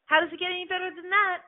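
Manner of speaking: high, wondering question to the universe. Pretend. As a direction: ask with open curiosity, voice lifted. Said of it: "asking the universe".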